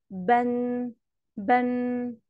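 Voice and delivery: "بن" on a level pitch, ending in a hummed n; audible final nun. Shown as a demonstration. Teacher has pupils listen for tanween, final n ringing in "بن". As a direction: hold the final n of "بن" like a ringing bell. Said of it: "النون أهي ساكنة".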